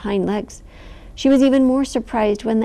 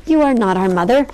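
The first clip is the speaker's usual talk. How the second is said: shouting; 2 semitones higher, 6 dB louder, faster than usual. A narrator's reading. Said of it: "shouted Clodon".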